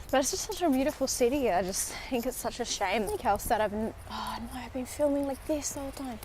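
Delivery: ordinary talk, nothing marked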